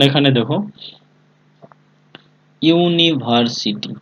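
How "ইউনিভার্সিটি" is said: "University" drawn out syllable by syllable; slow dictation. Shown as a demonstration. Teacher giving the class an example word, slow dictation. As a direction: stretch out "university" very slowly, as for note-takers.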